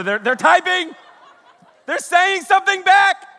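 high, loud squealed anticipation; hammed-up thrilled outburst; mock excitement. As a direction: shout it giddily, voice way up. Acts out eager wait for text reply.

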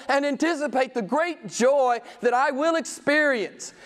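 high, fervent preacher's vow; preaching at full force. Acted. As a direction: declare it with fervour.

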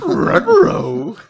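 high, loud cartoon dog "ruh-roh"; Scooby-Doo voice. Cartoon dog impression after a Scooby-Doo joke, high and loud.

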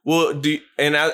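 ordinary talk, nothing marked

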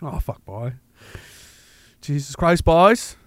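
attempted Newfoundland accent, drifting toward Cape Breton; tentative put-on Newfoundland accent. Shown as a demonstration. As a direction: have a rough go at a Newfoundland accent. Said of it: "do your best Newfoundland accent".

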